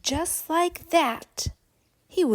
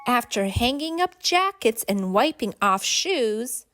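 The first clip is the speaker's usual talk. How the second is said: usual speaking voice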